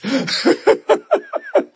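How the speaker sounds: bursting high laugh; sudden amused laugh; real laugh. Real, not acted. Laughing at his own story.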